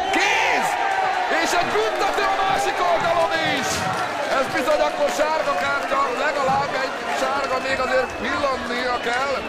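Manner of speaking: excited sports commentary. Real, not acted. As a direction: call the handball fast and excited. Handball, penalty at other end.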